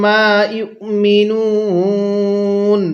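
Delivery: মা ইউমিনুন slow and drawn out; slow tajweed recital; slow Quran recitation. Shown as a demonstration. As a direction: chant মা ইউমিনুন slowly, stretching the vowels. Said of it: "হ্যাঁ, এরকমভাবে শিখব।".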